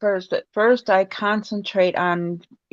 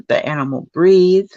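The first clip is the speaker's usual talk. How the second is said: ordinary talk, nothing marked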